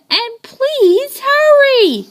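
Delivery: high, loud, slow pleading shout; boy yelling at the firemen to hurry; yelled plea. A narrator's reading. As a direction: beg them loudly to hurry. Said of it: "I yelled".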